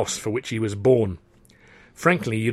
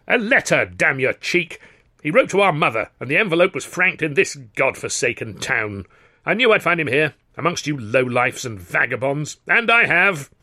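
pompous outrage in a raised voice; indignant; 3 semitones higher than usual. Acted. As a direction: accuse them with scornful indignation. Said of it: "was puce with indignation".

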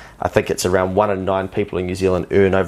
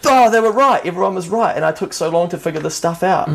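excited realisation; 9 semitones higher than usual. Retold or imitated. Recalling how everyone was right, excited then subsiding. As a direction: burst out, then ease into regret.

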